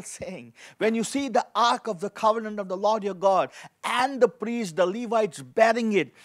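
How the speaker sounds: ordinary delivery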